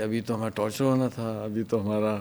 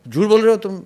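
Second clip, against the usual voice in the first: higher, louder mock shout of the general; angry accusation; 8 semitones higher, 8 dB louder than usual. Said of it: "ایسے غصے میں تھا". (غصے سے الزام لگانا)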